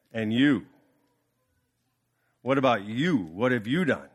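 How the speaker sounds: low, menacing questioning; cold demand. Acted. The CEO turning on the final manager with a low, cold demand.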